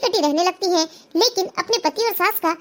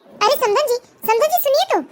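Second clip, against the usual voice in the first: raised pitch, urgent call to stop; calling after someone; 5 semitones higher than usual. Acted. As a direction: call after her as she walks off. Calling after the departing in-law.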